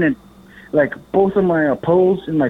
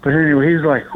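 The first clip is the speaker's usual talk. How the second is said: ordinary delivery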